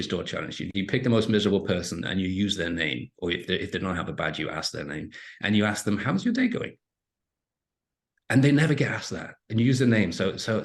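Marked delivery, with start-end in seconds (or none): 6.0-6.8 much higher, bright friendly ask; cheery greeting. Shown as a demonstration. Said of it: "You put a little emphasis on the".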